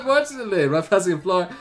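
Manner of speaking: ordinary talk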